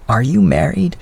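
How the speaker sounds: a hint of the old widow's voice; elderly lady voice. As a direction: speak as a kindly older woman. Mrs Callender asks.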